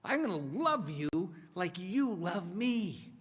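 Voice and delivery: high, loud, fervent promise, rushed at first then slowing; bold vow. Retold or imitated. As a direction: swear it with full conviction. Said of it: "That's why he said".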